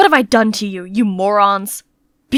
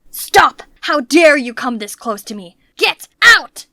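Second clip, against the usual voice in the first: higher, louder furious roar; 4 semitones higher, 3 dB louder, slower than usual. Acted. Master roaring at the maid to get out, furious. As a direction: blast her away with rage. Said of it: "but he roared again".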